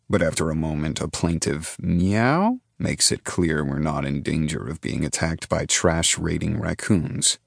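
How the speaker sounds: plain reading voice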